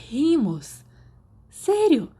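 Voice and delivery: high, loud disbelieving cry; shocked he doesn't know; incredulous. A narrator's reading. Sirius can't believe Remus doesn't know, loud and high.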